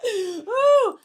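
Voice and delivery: high cackle rising into a whoop; Woody Woodpecker laugh. Real, not acted. Streamer laughing at chat, high Woody Woodpecker cackle. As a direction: laugh high, then let out a loud "ooh". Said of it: "almost sounded like Woody Woodpecker".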